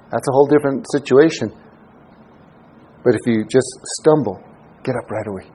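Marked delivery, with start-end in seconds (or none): 4.8-5.5 quiet urging. Real, not acted. Preacher urging to rise.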